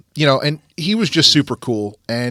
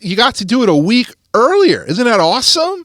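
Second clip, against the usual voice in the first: higher and louder, selling it as great news; mock cheerful dad; 8 semitones higher, 4 dB louder than usual. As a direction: pitch the early birthday like a treat. Dad cheerfully spins early birthday.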